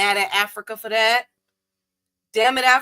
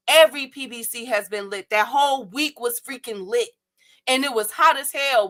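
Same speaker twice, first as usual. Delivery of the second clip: ordinary talk, nothing marked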